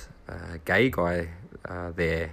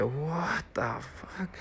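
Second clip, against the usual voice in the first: slow, soft and higher; hushed disbelief; 10 semitones higher, 8 dB quieter, much slower than usual. Retold or imitated. Friend staring at the mess, slow hushed disbelief. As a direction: drag it out, stunned.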